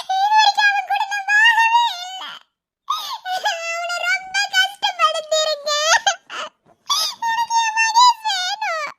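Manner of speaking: high, loud sobbing plea, slowing as it breaks; a mother's crying shout for her son; desperate crying plea. Acted. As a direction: wail at the doctor, begging through tears. Mother pleads with doctor for son.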